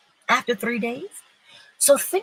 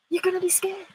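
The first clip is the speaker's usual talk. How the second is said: high mock-scared warning; 11 semitones higher, faster than usual. Preacher repeats warning, higher voice.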